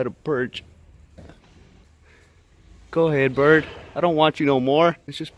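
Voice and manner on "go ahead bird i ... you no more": loud mock rejection. Real, not acted.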